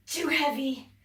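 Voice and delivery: ordinary delivery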